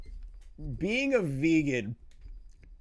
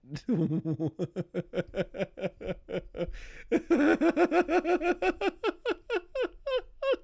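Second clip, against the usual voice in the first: high, helpless burst of laughter; can't stop laughing, cracking up; long laughter; 13 semitones higher than usual. Real, not acted. Reacting to a claim in a video, long helpless laugh.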